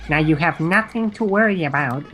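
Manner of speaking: lightly voiced eerie alien; a hint of an odd alien calm. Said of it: "she sounded like she was doing, like, an alien voice".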